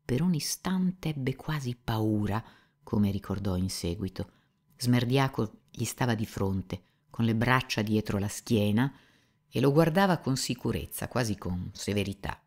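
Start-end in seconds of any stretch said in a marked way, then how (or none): none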